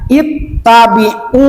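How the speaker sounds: "ittabi'u" with the u drawn out; long final vowel. Shown as a demonstration. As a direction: hold the last u of "ittabi'u". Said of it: "Panjangkan kalau setiap ada waw mati".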